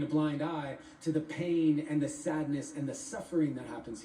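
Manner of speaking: rhythmic, deliberate poetry recital; spoken-word poem. Acted. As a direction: perform it as spoken word, riding the rhymes. Recites own poem after introducing it.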